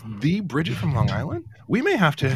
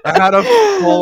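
mock wail; 9 dB louder than usual.

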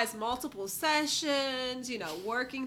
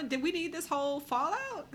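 usual speaking voice

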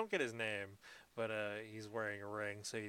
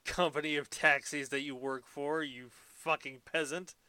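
loud, hammed-up indignant rant; over-the-top huffy tirade; mock outrage; 4 semitones higher, 11 dB louder than usual. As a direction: rail at the driver like an offended aristocrat. Acted indignant rant at taxi driver.

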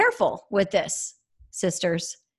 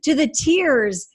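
bright retold; 7 semitones higher, 3 dB louder than usual. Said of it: "No, I told him".

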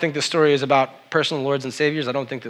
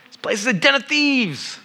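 higher, strained cry of a lightly acted Jesus; lightly voiced angry Jesus; 11 semitones higher than usual. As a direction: voice Jesus throwing out the traders. Jesus overturning tables in the temple.

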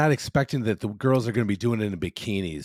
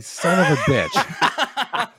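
slow, drawn-out laughing curse; exasperated curse; 5 semitones higher, much slower than usual. Retold or imitated. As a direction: drag the curse out slowly through a laugh. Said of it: "I'm like".

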